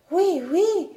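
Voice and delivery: cri de joie aigu et plus fort; s'écrier joyeusement. A narrator's reading. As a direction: lancer un oui enthousiaste d'enfant. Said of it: "s'écria Catherine". (Catherine reconnaît son nuage, s'écrie avec joie)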